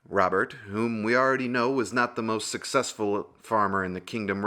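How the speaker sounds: plain reading voice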